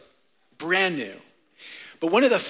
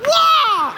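high loud karate kiai; sharp high karate yell; karate shout; 10 dB louder than usual. Shown as a demonstration. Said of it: "Let's do it together".

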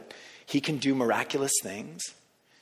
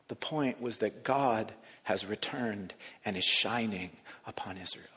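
hushed reverence; 5 semitones lower, 7 dB quieter than usual. Acted. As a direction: draw them close and fade to a whisper. Sermon climax hushed.

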